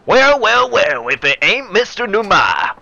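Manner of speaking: loud, high, drawn-out Texan greeting; hammed-up Texan hailing a rival; Texan accent. Shirakawa greets Numai in game dialogue, big Texan accent. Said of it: "offended with that accent".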